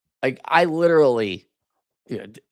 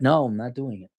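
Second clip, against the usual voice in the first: ordinary talk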